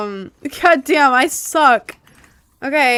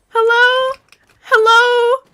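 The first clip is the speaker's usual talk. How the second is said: calling out; 12 semitones higher, 5 dB louder, much slower than usual. Real, not acted. Calling out in game.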